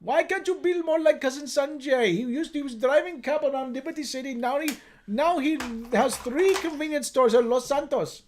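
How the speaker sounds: put-on nagging parent in an Indian accent; lilting put-on Indian accent. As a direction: hold up a relative as example, in an Indian accent. Indian parent act continues.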